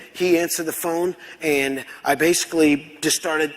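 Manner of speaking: usual speaking voice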